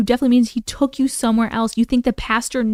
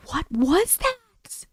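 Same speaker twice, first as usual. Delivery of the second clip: spooked; 9 semitones higher, 3 dB quieter, much slower than usual. Real, not acted. Spooked question.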